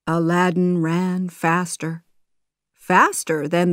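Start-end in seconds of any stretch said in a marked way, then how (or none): none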